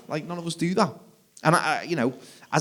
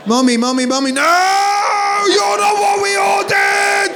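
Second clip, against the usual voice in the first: put-on much higher, louder, drawn-out child's shriek; kids screaming; 7 dB louder than usual. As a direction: wail like a toddler rejecting dad. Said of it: "they scream louder".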